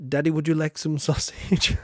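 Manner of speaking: quoting a film line. Retold or imitated. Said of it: "one of the funniest things".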